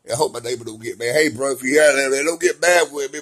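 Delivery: ordinary delivery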